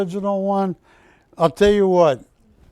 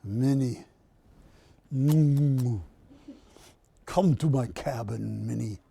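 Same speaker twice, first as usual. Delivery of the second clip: a low, hushed, lightly voiced Mickey Mouse wooing; soft, low cartoon mouse come-on; mouse accent Mickey; 5 semitones lower, 6 dB quieter than usual. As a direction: coax Minnie to the cabin in a mild mouse voice. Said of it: "somewhat of a mouse accent".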